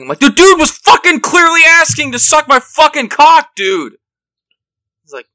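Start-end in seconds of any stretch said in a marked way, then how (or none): 0.2-3.9 furious high-pitched shout, retelling a row; loud, high, exasperated tirade at a friend; going off on someone. Retold or imitated. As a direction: yell it in outraged disbelief, full volume. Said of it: "I went off on him so bad".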